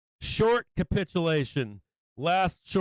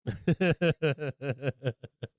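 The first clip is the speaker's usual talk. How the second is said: soft amused chuckle; real laugh; 3 semitones lower, 3 dB quieter than usual. Real, not acted. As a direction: laugh quietly to yourself. Laughing at the call-out.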